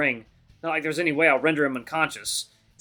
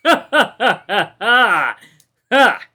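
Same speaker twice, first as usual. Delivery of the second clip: high, loud burst of separate ha-has; big acted villain-like guffaw; loud booming laugh; 7 semitones higher, 10 dB louder, much slower than usual. A narrator's reading. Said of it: "he starts to laugh. Loudly".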